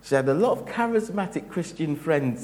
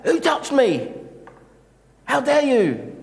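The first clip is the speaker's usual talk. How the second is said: much higher, louder indignant shout; mock outraged demand; 12 semitones higher, 8 dB louder than usual. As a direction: bark it as if offended.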